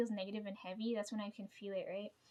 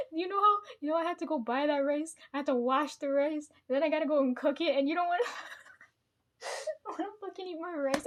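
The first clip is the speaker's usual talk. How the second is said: higher, louder, laugh-crying complaint; mock tearful rant; 6 semitones higher, 6 dB louder than usual. Ranting at her aunt about the rice, in a mock tearful wail. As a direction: whine it out, half-sobbing through laughs. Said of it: "I was so mad".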